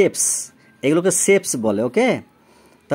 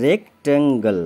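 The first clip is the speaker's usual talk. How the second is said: drawn-out "rectangle" in separate beats; syllable by syllable; slower than usual. Shown as a demonstration. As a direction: sound out the three syllables of "rectangle". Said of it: "তাহলে তিনটে syllable".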